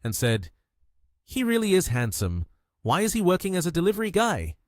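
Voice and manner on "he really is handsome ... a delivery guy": high, surprised wondering. A narrator's reading. Girl seeing the handsome delivery guy, surprised. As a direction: wonder aloud in a raised pitch. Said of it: "also surprised".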